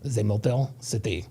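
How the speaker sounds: a quiet, playful French accent. Shown as a demonstration. Host saying Detroit for chat in French. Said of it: "say it with the French accent".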